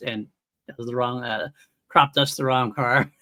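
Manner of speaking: usual speaking voice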